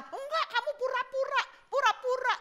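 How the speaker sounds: very high-pitched accusing wife; angry wife. Acted. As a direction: play a wife throwing accusations, voice shot up. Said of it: "Dia nangis, marah, ngamuk". (istri ngamuk menuduh, suara melengking tinggi)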